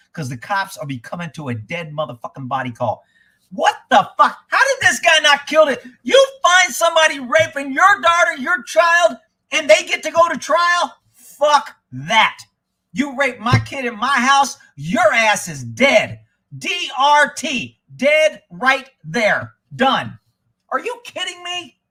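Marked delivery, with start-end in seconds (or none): 3.5-4.4 loud, sharp outraged cry; outraged shout. Real, not acted. Outraged reaction to news story. 4.5-20.2 loud, high furious yelling; furious ranting shout. Real, not acted.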